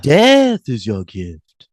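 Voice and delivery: spooky Slayer intoning with relish; Count from Sesame Street. Retold or imitated. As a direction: stretch each word like a puppet vampire. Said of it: "sort of like the Count from Sesame Street".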